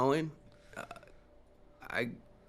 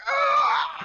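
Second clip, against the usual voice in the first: shrill monster shriek; creature scream; 11 dB louder than usual. Acted. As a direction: scream like a wounded beast. Bugbear screams, still alive.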